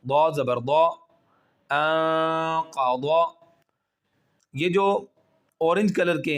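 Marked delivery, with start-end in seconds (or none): 1.7-3.4 انقضا with thick heavy ض, slow. Shown as a demonstration. Teacher reading to students, heavy ض drawn out. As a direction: read انقضا slowly with a full heavy ض. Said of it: "موٹا کر کے پڑھیں گے".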